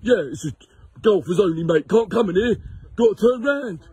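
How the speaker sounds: hammed-up jobsworth, pitched well up; mocking high-pitched attendant turning drivers away; grumpy kiosk man impression. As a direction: put on a high, silly voice for a grumpy gatekeeper. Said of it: "I don't know why he talks like that".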